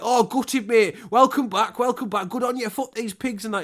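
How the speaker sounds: high, loud chirpy bloke voice; hammed-up cheery lad outside the prison gate; mock matey ex-con greeting a released prisoner. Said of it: "They're like".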